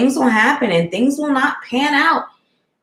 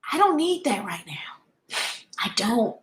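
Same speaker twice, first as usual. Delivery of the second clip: whispered, softer self-reassurance; 8 dB quieter than usual. Real, not acted. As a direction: breathe it out to yourself. Softly reassuring herself.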